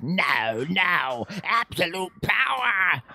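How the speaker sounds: Emperor Palpatine impression. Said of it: "especially when he's like".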